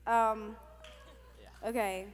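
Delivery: ordinary talk, nothing marked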